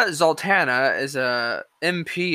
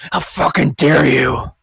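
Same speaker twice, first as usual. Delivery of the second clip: slow, louder mock indignation; drawn-out sarcastic outrage; 2 semitones lower, 4 dB louder, slower than usual. Vlogger mocking offended critics, slow sarcastic outrage. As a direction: stretch it out in fake horror. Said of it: "I'm being extremely sarcastic here".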